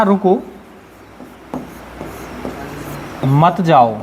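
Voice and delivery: "रुको", long pause, then a low "मत जाओ"; pause after रुको. Shown as a demonstration. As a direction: break after रुको, keep मत जाओ together, dropping in pitch. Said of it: "हमारा बोलने का तरीका चीज़ों को बदल देता है". (रुको के बाद लंबा विराम, फिर धीमे सुर में मत जाओ)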